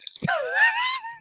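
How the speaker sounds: sudden squeaky laugh up high; high squealing laugh. Real, not acted. Laughing at his own fake cheer, high squeal. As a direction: let a high shriek of a laugh escape.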